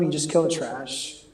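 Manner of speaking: ordinary talk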